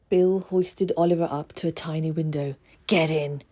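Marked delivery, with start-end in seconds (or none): none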